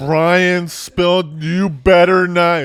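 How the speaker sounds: high, loud, slow warning; mock warning. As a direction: drag out a playful threat. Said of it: "what would happen if she had said".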